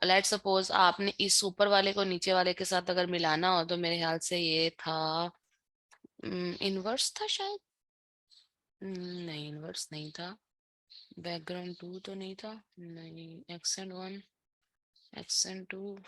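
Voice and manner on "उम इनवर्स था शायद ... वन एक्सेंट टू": muttering softly to oneself; hushed, tentative self-talk while trying to recall; thinking aloud. Real, not acted. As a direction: trail through the options under your breath.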